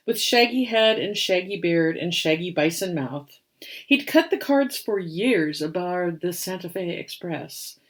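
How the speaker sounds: put-on lively read-aloud for children; rhyming story narration. A narrator's reading. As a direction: tell the rhyme with storybook bounce. Reads picture book aloud.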